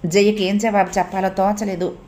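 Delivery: ordinary delivery, nothing marked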